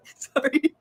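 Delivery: high, loud word through real laughter; laughing apology. Real, not acted. Vlogger cracking up at own peg leg jibe, laughing.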